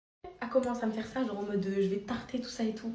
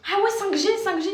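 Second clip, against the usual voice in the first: much higher and louder jeer; mocking stranger; 12 semitones higher, 8 dB louder than usual. Vlogger imitating the woman's loud jeer. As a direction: taunt like the angry woman.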